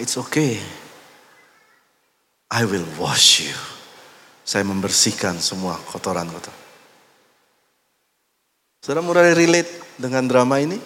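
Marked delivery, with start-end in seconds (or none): 0.0-6.7 gentle Jesus. Acted. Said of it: "Yesus bilang".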